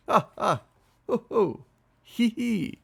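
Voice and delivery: high, loud fake laughs in pairs; whinnying, braying laughter. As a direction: bray out silly horse laughs. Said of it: "they whinnied and brayed".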